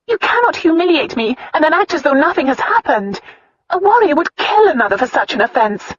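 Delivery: put-on a proud young wife confronting her husband; upset young wife. A narrator's reading. Wife's dialogue voiced higher.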